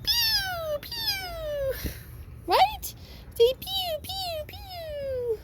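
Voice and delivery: high squeaky firework noises; playful pew pew. Real, not acted. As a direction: play up the bangs as toy sounds. Mimicking fireworks for puppies.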